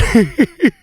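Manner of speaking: high-pitched laugh; helpless high giggle. Real, not acted. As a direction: laugh at your own predicament. Laughs at the distant park.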